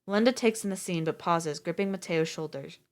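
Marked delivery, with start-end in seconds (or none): none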